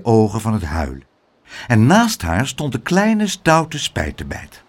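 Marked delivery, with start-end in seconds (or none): none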